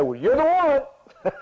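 high, slow and exaggerated, like he's found the one; a hammed-up declaration of certainty; mock eager exclamation. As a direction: play the servant blurting it out too soon. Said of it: "oh, he didn't say".

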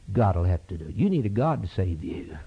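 ordinary talk, nothing marked

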